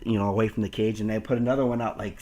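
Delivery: usual speaking voice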